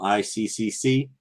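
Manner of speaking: letter by letter, unhurried; slow down to get every C in; slow, careful spelling. Real, not acted. Host announcing a convention without dropping a C, slowly spelled out. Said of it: "I always try to say it slow".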